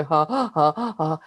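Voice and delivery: panting ha ha laugh on the in-breath; mock chimp panting laugh. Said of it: "That's how chimps laugh. They go".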